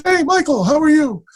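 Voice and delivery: high, hearty imitated phone greeting; Chazz. Actor phoning his former student, high imitated greeting. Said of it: "He goes".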